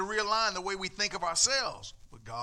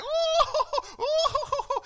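mock ooh; 10 dB louder than usual. Mimicking feel-good churchgoers.

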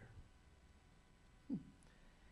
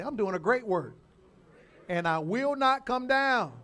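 loud, emphatic declaration, rising in force and slowing; like you mean it; 5 semitones higher, 10 dB louder than usual. Shown as a demonstration. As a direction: proclaim it with full conviction for the congregation to repeat. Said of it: "you gotta say it like you mean it".

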